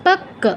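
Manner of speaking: "পক্ক" as pak-ka; double k. Shown as a demonstration. Teacher extending the rule, "পক্ক" as pak-ka. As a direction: hold the k in "পক্ক", no glide. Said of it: "double ক যদি থাকে".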